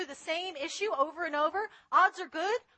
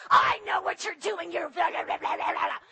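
loud, high yelling right in her face; cussing shout; 3 semitones higher, 5 dB louder than usual. Retold or imitated. Homeless woman yelling at her in Starbucks, angry shout. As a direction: shout accusations at a stranger. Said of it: "she starts cussing at me".

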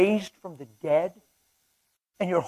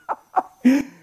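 happy laugh; 8 semitones higher, 3 dB louder than usual. Real, not acted. Laughs after Holy Ghost train.

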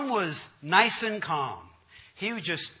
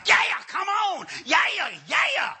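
high, loud mock revival holler; excited preacher impression; 11 semitones higher, 5 dB louder than usual. Wild pastor working his prayer line, excited shout. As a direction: whoop like a fired-up pastor. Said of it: "This other guy was like".

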